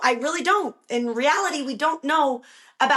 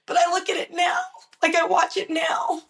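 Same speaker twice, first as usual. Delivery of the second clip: ordinary talk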